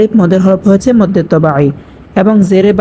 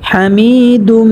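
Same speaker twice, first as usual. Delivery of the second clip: chanted slowly, a touch higher; slow recitation; 3 semitones higher, much slower than usual. Shown as a demonstration. Recites Quranic style. (ধীরে সুর করে পড়া)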